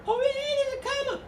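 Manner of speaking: high-pitched mock pupil squeal; squeaky eager student. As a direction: play the student who just spotted the comma. Teacher voices an eager student.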